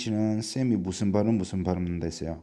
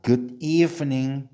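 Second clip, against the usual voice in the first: slow, higher model of "Good evening"; repeat-after-me model; 4 semitones higher, 4 dB louder, much slower than usual. Shown as a demonstration. Teacher has learners repeat after her, slow model reading. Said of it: "자, 따라합시다".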